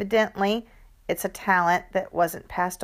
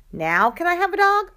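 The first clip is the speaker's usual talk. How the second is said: hopeful ask; 11 semitones higher, 6 dB louder than usual. Acted. Kid asks again eagerly.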